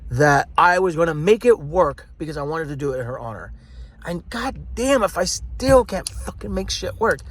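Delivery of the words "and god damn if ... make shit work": higher, slow exasperated venting; real frustration. Real, not acted. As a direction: drag the words out in exasperation, pitched up. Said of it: "what's so frustrating".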